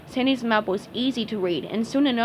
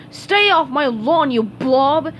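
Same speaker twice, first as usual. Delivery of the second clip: loud whiny grump; whiny yell; 4 semitones higher, 6 dB louder, slower than usual. A narrator's reading. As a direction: yell it like a cranky neighbor. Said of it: "he yelled in a whiny voice".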